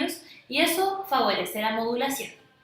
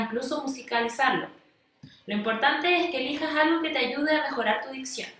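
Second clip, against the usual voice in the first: ordinary delivery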